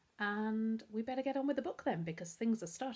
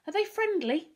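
hopeful; 12 semitones higher, 8 dB louder than usual. A narrator's reading. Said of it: "Bertolt asked hopefully".

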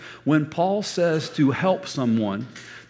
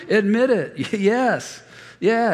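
cartoon angel; 6 semitones higher than usual. Cartoon angel urges him to admit it, higher and laughing. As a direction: play the sweet little angel urging him. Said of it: "the little angel you see in the cartoon".

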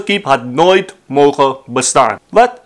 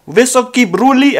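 calling out; 7 semitones higher than usual. Retold or imitated. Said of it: "mag hij schreeuwen".

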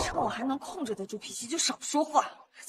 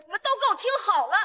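angry; 6 semitones higher, 5 dB louder, much faster than usual. Acted. Heroine confronts the family.

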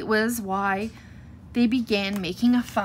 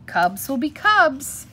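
slow, higher-pitched verbal shrug; shrugging indulgence; 5 semitones higher, 4 dB louder, slower than usual. A narrator's reading. Papa bear excusing the cubs to Mama, shrugging it off. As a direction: stretch it out like an easygoing excuse. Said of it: "looked at Mama and shrugged".

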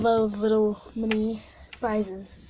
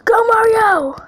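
high, loud rallying yell; cheering shout; 12 semitones higher, 10 dB louder than usual. Real, not acted. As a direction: cheer the hero on at full voice. Cheers game character on.

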